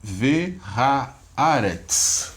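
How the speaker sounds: Ve haaretz drawn out syllable by syllable, very slow; teacher modelling Ve haaretz at a crawl; slow syllable-by-syllable reading. Shown as a demonstration. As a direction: spell out Ve haaretz slowly for the class. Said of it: "como é que ficou a leitura agora?".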